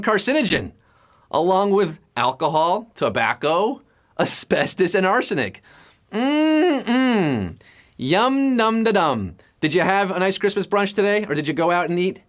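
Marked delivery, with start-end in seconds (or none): 6.1-9.4 yummy hum. Pretend. Silly yummy noises.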